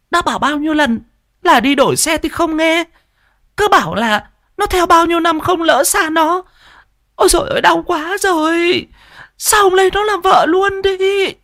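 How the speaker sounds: very high, scolding wife's voice; wife scolding her clumsy husband; exasperated wife. Acted. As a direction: nag him through the pain. Wife scolds over old bike.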